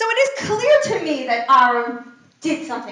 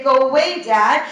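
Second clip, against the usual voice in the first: put-on louder, a bratty kid snapping at dad; mock child's brush-off; 7 dB louder than usual. Children telling their father to go away, mock snappy.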